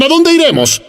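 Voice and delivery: high pleading disciples' question; imitating the disciples. Preacher voicing disciples answering Jesus, high and pleading. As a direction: lift it high like begging. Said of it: "Los discípulos dijeron".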